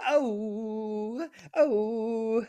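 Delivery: high, fake-hurt oh; hammed-up ouch; mock wounded cry. Real, not acted. Mock hurt at cargo jab.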